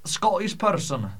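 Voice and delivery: normal talking voice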